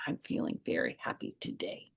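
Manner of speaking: soft whispered confession. Acted. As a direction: hush it right down. Narrator's quiet feeling line.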